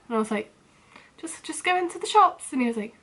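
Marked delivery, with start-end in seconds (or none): 1.2-2.5 stammered high answer, forcing politeness; nervous high reply. Retold or imitated. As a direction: get the words out quickly and keep him at a distance.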